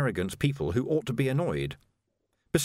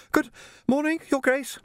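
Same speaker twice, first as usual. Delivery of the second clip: put-on much higher, polite young Jocasta, a touch breathless; young woman voiced. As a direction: play a well-mannered girl treading water.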